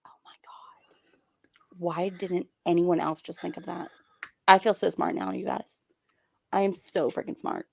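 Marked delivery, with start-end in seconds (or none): 0.1-1.8 hushed unvoiced whisper. Real, not acted. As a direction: whisper it to yourself. Whispered aside.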